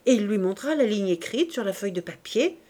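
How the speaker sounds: plain reading voice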